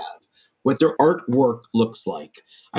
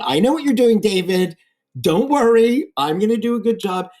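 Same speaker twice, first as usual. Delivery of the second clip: laughing reply; 6 semitones higher than usual. Retold or imitated. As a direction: tease him while promising a good job. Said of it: "he's laughing at me saying".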